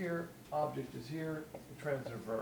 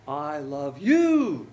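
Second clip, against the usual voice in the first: drawn-out, loud "you" at the end; stress on YOU; 7 dB louder, much slower than usual. Shown as a demonstration. Preacher voicing a newlywed's personal love, stress on YOU. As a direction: run through "I love" and swell long on "you". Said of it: "Very attractive".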